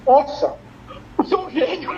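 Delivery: amazed, half laughing; amused. Retold or imitated. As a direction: gush at the genius idea through a laugh. Retold own amazed reaction. (espanto rindo)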